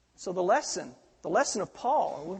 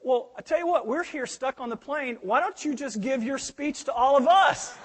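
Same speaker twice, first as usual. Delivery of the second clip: 1 lady's suggestion; 6 semitones higher, 6 dB louder than usual. Retold or imitated. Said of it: "the lady said".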